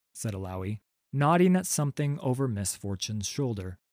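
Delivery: ordinary delivery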